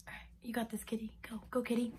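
soft whispered pep talk; hushed encouragement to the cat; whispered cheer. Retold or imitated.

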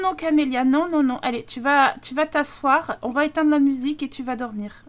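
ordinary talk

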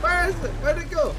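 high, loud frantic questions; scanning for the monster, scared; panicked searching. Real, not acted. Lost sight of the monster.

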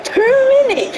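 high, incredulous squeal at the number; can't believe it's only two; outraged disbelief. Real, not acted. Outraged at stoppage time.

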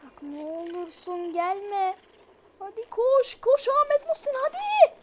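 pleading rising to high, loud frantic yells; panicked screaming. Real, not acted. Gamer begging the monster not to come, then screaming to run. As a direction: build from begging to a terrified shriek.

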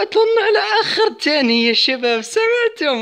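high, laughing and breathless with relief; laughing with excitement. Real, not acted. Gamer tells viewers he won in time, laughing excitedly. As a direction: still laughing from the win, tell the viewers. Just won at the last second.

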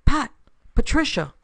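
high-pitched call to a friend. A narrator's reading. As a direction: call the name from a distance. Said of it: "Donna Barstow's voice called".

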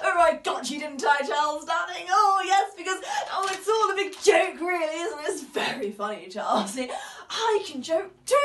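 loud, crying and shouting in bursts; tearful shouting. Acted. Wife crying and shouting at Charles over the joke. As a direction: let the hurt burst out as a shout.

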